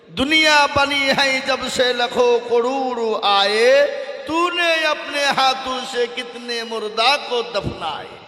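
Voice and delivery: lilting recital of a sacred verse; poem recitation. Acted. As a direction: intone the couplet like a preacher's poem. Recites an Urdu couplet.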